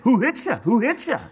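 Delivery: high-pitched acted jeer; taunting. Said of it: "start hitting him and saying".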